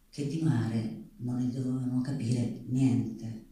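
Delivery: ordinary talk, nothing marked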